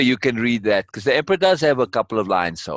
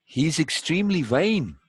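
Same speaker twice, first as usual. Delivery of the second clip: put-on boy pupil; 2 semitones higher, 5 dB quieter than usual. Boy telling his master what he sees, boy pupil. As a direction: answer as a young pupil, voice raised in pitch.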